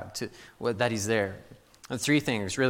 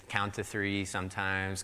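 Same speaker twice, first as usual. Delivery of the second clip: usual speaking voice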